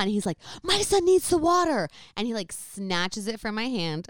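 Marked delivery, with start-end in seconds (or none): 0.6-1.9 high, loud, curt demand; imitating a furious brother; angry snap. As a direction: grab the water from her. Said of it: "he's super angry".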